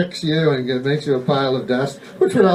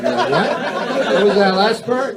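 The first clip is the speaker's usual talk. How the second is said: high, puzzled interruption; acted startled double take; taken aback; 7 semitones higher than usual. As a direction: break in with a raised, incredulous query. Acted listener reacting to immortality.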